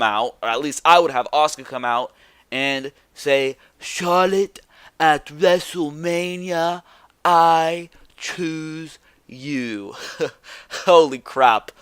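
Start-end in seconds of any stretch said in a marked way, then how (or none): 3.8-9.9 slow, high mock Japanese woman's accent, drawn out. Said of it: "did I just do a Japanese accent". 10.2-11.7 laughing. Real, not acted.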